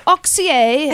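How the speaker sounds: slow, drawn-out mock French pronunciation of Oxier; mock French accent. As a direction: Oxier stretched out in a lightly faked French accent. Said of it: "the French would say".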